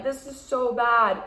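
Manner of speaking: slow, drawn-out fretting; mock panic. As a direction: play up the panic, slowly. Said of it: "the more I'm like".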